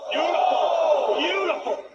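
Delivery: slow, loud, marvelling call; excited, drawn out. Real, not acted. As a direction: stretch out each beautiful in awe. Commentator marvels at move.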